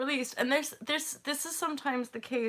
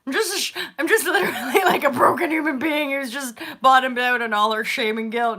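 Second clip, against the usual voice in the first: laughing confession; 9 dB louder than usual. Real, not acted. Laughing at her own confession.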